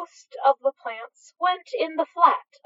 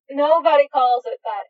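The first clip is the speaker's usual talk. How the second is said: groaning; 3 semitones higher, 7 dB louder than usual. A narrator's reading. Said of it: "Crowley groaned".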